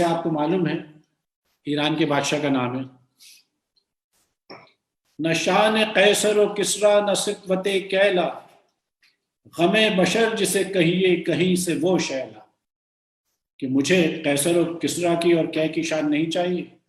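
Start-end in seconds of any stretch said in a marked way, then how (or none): 5.2-12.6 sustained Urdu verse reading; deliberate ghazal delivery with held syllables; poem recitation. Acted. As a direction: declaim the couplet in measured phrases. Recites the couplet in full.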